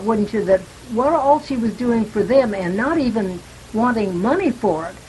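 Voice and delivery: usual speaking voice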